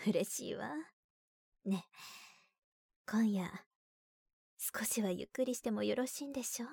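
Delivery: soft, sweet, wheedling whisper; coaxing whisper. Acted. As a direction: whisper it to coax your husband. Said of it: "甘える語調になった".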